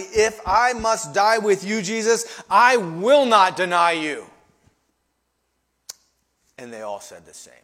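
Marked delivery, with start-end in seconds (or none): 0.0-4.3 loud, slow, weighted vow; emphatic. Acted. Peter swearing loyalty to Jesus, loud and emphatic. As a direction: hammer out the promise, slow and forceful. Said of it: "Peter said emphatically".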